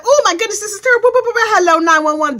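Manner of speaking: mock panic. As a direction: squeal it like a flustered caller. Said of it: "you're like".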